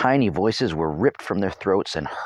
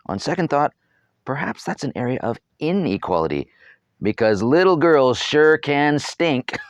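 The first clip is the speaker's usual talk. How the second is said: wry aside; 8 semitones higher, 4 dB louder than usual. A narrator's reading.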